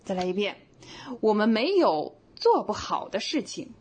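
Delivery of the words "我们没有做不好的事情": 做不好 bound together as an adjective; slow, 做不好 run together; 做不好 as one unit. Shown as a demonstration. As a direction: stress 做不好 as one word. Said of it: "我们换一种读法".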